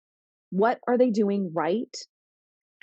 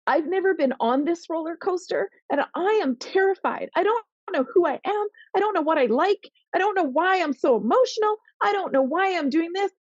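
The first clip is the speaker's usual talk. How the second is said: voiced scared teenager, pitched much higher; overwhelmed teen; 9 semitones higher than usual. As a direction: pour out the confusion in a high, pleading run. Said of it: "What they wanna know is".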